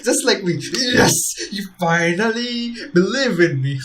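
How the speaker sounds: loud, high gleeful cheer; excited mock quote. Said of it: "she's like".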